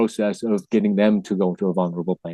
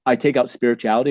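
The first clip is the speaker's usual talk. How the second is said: ordinary talk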